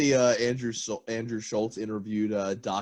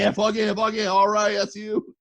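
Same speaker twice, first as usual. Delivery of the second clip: high, loud rowdy cheering; drunk cheering; 10 semitones higher, 4 dB louder than usual. Retold or imitated. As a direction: whoop along like drunk boaters. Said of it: "people are like".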